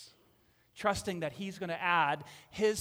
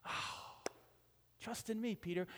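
soft, hushed reassurance; 4 semitones higher, 4 dB quieter than usual. Retold or imitated. Jesus softly reassuring Peter, quiet and tender. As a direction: coax him back softly.

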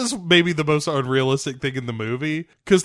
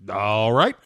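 imitated easy giving-in, lilting up at the end; mock pushover acceptance; compliant; 2 semitones lower than usual. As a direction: act suddenly agreeable. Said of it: "goes from mild resistance to just".

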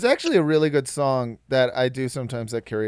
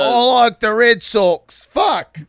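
put-on Boston accent; 6 semitones higher, 5 dB louder, slower than usual.

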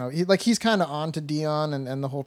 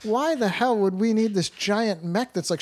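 ordinary delivery

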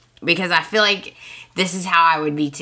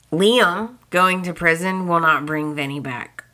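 usual speaking voice

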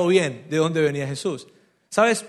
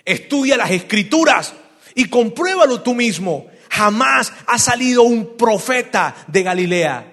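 fariseos desdeñosos; 3 semitones higher, 5 dB louder, slower than usual. Retold or imitated. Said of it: "contestaron ellos".

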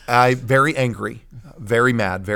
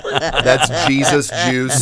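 laughing; 2 semitones higher, 5 dB louder, much slower than usual. Real, not acted. Joking about spilled coffee.

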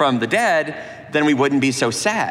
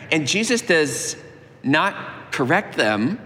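usual speaking voice

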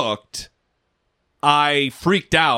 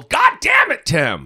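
mock yelling; 13 semitones higher, slower than usual. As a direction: yell at him in pretend outrage. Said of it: "Yelling at him".

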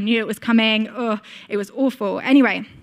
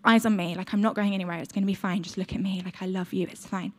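much softer, tender and fading; soothing whisper-soft dad; 3 semitones lower, 8 dB quieter than usual. Retold or imitated. Dad calms his panicking child under the mask, tender and soft. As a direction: hush her gently, growing ever softer.

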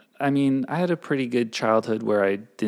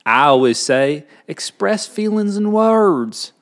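slow, higher Southern drawl; Southern accent; 6 semitones higher, slower than usual. Pretend. Passing on the next rule as homespun advice, Southern accent. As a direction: stretch the words out like a Southerner. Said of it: "do it in a Southern accent".

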